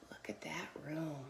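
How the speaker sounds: barely voiced hush of wonder; soft, low whispered marvel; awed whisper. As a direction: whisper it to the children in awe. Hushed wonder at the picture.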